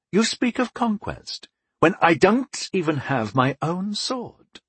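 bitter but philosophical complaint. Acted. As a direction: complain with stoic resentment. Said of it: "Angwin complained, bitterly but philosophically".

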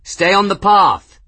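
loud, high croak through smoke; croaked shout. A narrator's reading. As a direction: croak it back over the fire. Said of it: "he croaked back".

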